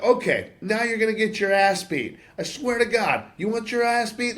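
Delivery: loud, high-pitched threat; worked-up shout reenacting a fight; retold angry yelling. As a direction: yell it as a road-rage challenge. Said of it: "I'm fucking yelling at this dude".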